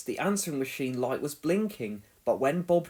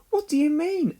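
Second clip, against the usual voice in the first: curious Dizzy; 12 semitones higher, 5 dB louder than usual. A narrator's reading. Dizzy asks curious question.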